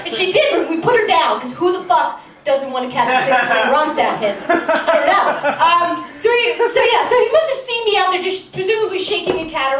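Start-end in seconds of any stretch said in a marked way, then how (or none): none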